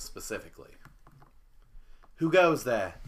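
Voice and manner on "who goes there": louder, higher challenge from a gruff woman; gruff female voice. Acted. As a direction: call out gruffly through the door. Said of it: "you hear a gruff voice".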